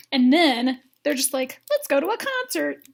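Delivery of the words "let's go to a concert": high, chirpy suggestion; mock giddy. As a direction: chirp it like an eager date. Imitates excited couple.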